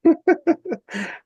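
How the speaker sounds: squeaky burst of laughter; high real laugh. Real, not acted. Laughing at dad joke.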